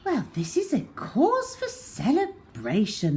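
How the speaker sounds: delighted granny; Grandma celebrating; grandma exclaims. A narrator's reading. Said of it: "exclaimed Grandma".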